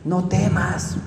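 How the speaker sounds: quiet comfort to a frightened follower; calm reassurance. Retold or imitated. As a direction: gently say do not fear. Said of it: "les dio palabra".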